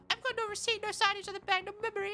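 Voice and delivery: Shia. Acted. Streamer pleading through the burrito poem's end, high impression voice. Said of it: "do that voice that Shia does".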